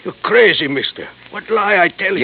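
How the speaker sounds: angry outburst. Acted. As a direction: explode at the accusation. Accused of lying, he flares up.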